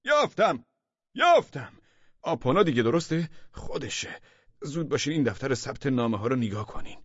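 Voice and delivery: high, loud eureka cry easing into urgent orders; triumphant shout. A narrator's reading. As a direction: shout it like Archimedes making his discovery. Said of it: "فریاد زد". (فریاد پیروزمندانهٔ کشف)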